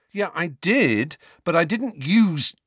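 sharp, rising protest; angry. Acted. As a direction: start to object hotly. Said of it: "Harry began angrily".

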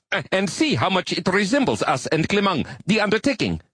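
excited yelp. Acted. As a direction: yelp it out, thrilled. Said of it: "Highly pleased, the quasi-arachnid yelped".

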